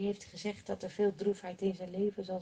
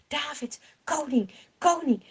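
urgent call; 5 semitones higher, 4 dB louder than usual. Acted. Messenger rushes in to king.

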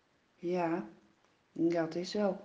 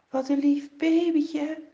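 put-on higher child voice cooing over the baby; a little sister in love with the baby; delighted child; 6 semitones higher, 4 dB louder than usual. Child admires newborn brother.

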